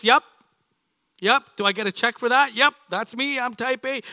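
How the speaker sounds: a keen volunteer, chuffed to be picked; proud type A. Retold or imitated. Spouse in the pews proudly claiming type A, loud and chuffed. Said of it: "my type A is went like this".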